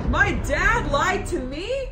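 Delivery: high, loud, slow incredulous question; hammed-up shock, drawing the words out; shocked disbelief. Daughter realising dad lied to her, hammed-up disbelief. Repeats shock at dad's lie.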